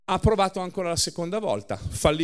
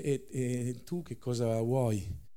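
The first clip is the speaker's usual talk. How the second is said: faint voice; 3 semitones lower, 7 dB quieter, slower than usual. A narrator's reading. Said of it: "con la voce flebile".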